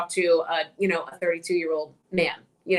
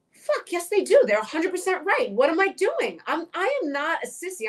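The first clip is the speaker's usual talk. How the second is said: fired-up realization; 9 semitones higher than usual. Retold or imitated. Retold self-realization, fired up.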